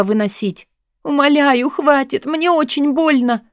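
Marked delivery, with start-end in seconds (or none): 1.0-3.4 high, desperate plea in pain; cracking cry. A narrator's reading. Woman begging him to stop hurting her, cracking cry. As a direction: cry out as if the voice is giving way. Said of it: "срывающимся голосом закричала я".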